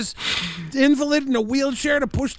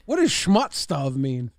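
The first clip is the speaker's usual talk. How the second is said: low puzzled voice; 11 semitones lower, slower than usual. Acted. Puzzled low slow question.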